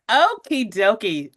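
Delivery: normal talking voice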